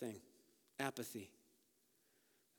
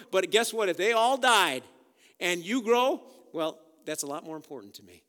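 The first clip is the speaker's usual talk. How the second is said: ordinary delivery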